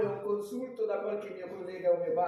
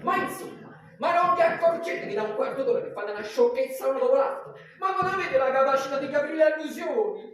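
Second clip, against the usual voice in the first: angry; 3 semitones higher, 7 dB louder than usual. Acted. Doctor scolding the guests.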